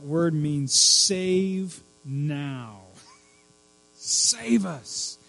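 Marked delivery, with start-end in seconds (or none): 4.0-5.2 high, strained plea for rescue; distressed cry. Acted. Preacher acts out crowd needing a saviour, distressed cry. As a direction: cry out in desperation, pitched up. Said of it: "what you shout out when you are in absolute distress".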